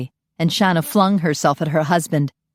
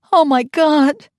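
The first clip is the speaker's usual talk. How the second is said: high, loud panicked cry; 10 semitones higher, 5 dB louder, slower than usual. Acted. Mother fearing for her son, panicked. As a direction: let the fear burst out.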